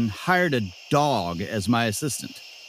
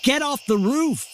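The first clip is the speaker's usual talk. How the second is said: higher urgent call; urgent yell; 14 semitones higher than usual. Acted. Host calling up to the guest on the roof, higher and urgent. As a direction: order her down fast.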